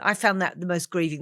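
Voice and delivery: normal talking voice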